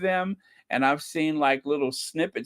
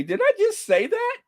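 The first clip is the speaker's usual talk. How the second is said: mock disbelief. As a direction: squeak it as if stunned by your own words. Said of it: "take another sip and see what happens".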